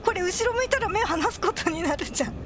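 ordinary talk

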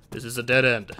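ordinary talk

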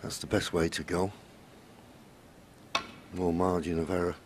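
usual speaking voice

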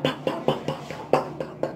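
soft, voiceless popping "pa" sounds; rapid-fire punch effects made by mouth; imitated punching sounds. Storyteller mimicking the fight, mouthed blow sounds.

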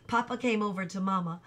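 plain reading voice

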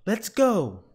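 high, loud rallying cry; urgent call. A narrator's reading. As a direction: spur a partner into a rescue. Fireman rallies to rescue.

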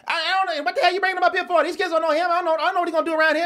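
loud, high, furious father scolding his son; angry father yelling. Retold or imitated. Father chewing out his son about a guest, angry yell. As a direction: bark it like an enraged dad.

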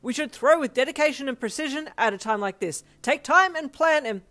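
high, loud, earnest lecturing; screamed instructions. A narrator's reading. Ida instructing teammates mid-fight, shouting frantically. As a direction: insist at the top of your voice. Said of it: "Ida was still screaming random instructions".

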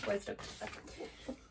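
whispered to herself. Real, not acted. Reader answers her own question in a whisper. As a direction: breathe it out in a whisper.

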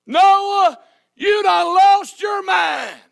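slow, loud, high drawn-out jeer; mocking shout. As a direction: stretch it out to ridicule him. Mocker calls Noah crazy.

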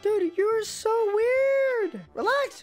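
high squeaky voice through a voice changer; voice changer girl voice. Acted. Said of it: "So I put on a voice changer".

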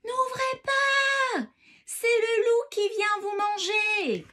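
high, drawn-out yell; shouted warning. A narrator's reading. Emma calling down the chimney to warn Grandmother, shouting. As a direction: holler it loud and slow, every syllable stretched. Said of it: "crie par la cheminée".

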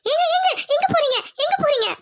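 high-pitched worried call; anxious calling out. Acted. Wife calling after husband as he storms off, anxious. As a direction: call after him urgently, pitch jumping up.

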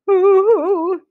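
high, loud hooting "ooh"; storybook owl fright; scared owl. Acted. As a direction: hoot in alarm.

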